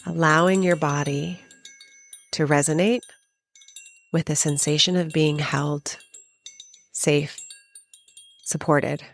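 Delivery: spacious, even meditation voice with long pauses; guided meditation. Acted. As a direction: guide the listener gently inward, phrase by phrase.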